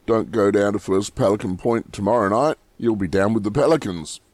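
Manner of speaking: quick joking aside; fast. Real, not acted. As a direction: rush through the warning. Warning joke, said quickly.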